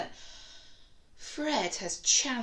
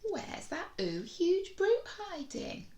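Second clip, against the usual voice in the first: playful search; 12 semitones higher than usual. Acted. Teacher hunting the card playfully.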